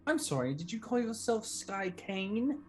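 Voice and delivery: ordinary delivery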